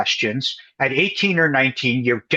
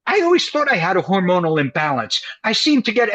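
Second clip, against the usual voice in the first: innocent dodge; 7 semitones higher than usual. Retold or imitated. Acting his evasive answer to therapist.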